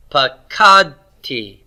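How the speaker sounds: "pakadti" slowly, long KAD and light tee; stress on root two. Shown as a demonstration. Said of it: "It's on root two".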